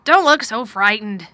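loud, slow, troubled urging; a touch of worry, drawn out and forceful; worried. A narrator's reading. Guardian to his frightened ward, worried and emphatic. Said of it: "he said in a worried voice".

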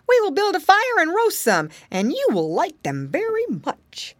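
high-pitched monkey voice; eager monkey. A narrator's reading. Monkey promising roast chestnuts to piggy boy, high and eager. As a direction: propose a treat as a chirpy little monkey.

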